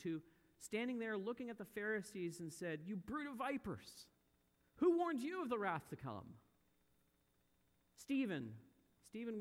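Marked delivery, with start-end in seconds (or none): 2.8-6.3 higher-pitched preacher's rebuke, building in force; prophetic rebuke. Acted. Preacher voices John rebuking Pharisees.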